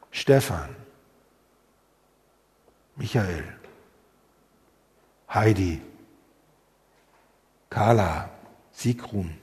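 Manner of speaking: low soft calling of names; tender name calling. Acted. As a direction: call each name gently with long pauses. God calling each by name.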